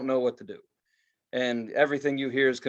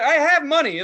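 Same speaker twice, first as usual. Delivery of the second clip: much higher, louder indignant protest; indignant outburst; 11 semitones higher, 9 dB louder than usual. Retold or imitated. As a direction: insist loudly that you can pay. Retold indignant protest to waiter.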